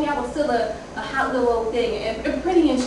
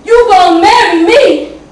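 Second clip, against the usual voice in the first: menacing yell; 8 semitones higher, 15 dB louder than usual. Acted. Dangling kids out the window.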